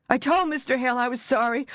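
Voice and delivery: desperate pleading. Acted. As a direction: beg through panic. Buried man begs forgiveness.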